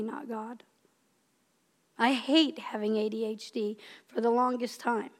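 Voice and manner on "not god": whispered afterthought. Real, not acted. Preacher whispering that she is angry at herself. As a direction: drop to a whisper for the admission. Self-correcting aside.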